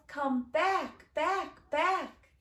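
lightly voiced child calling. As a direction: call after drifting balloons. Child calling balloons back in rhyme.

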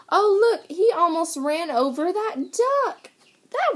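surprised. Real, not acted. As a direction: exclaim in shock for little listeners. Reacting to the near miss.

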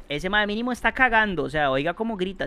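usual speaking voice

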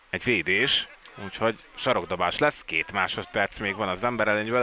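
usual speaking voice

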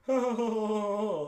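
sarcastic forced laughter; mocking her laugh. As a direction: fake high loud ha-ha laugh. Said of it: "you thought I was gonna be".

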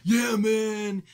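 a hint of the old metalhead's "yeah, man"; old metal dude. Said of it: "an old metal dude".

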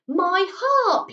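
overjoyed at finding a lost treasure; cried out. A narrator's reading. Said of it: "he cried".

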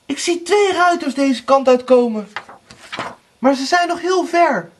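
high, loud report; lookout sister calling. A narrator's reading. Anna sees two riders, calling high and loud. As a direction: call the sighting down.

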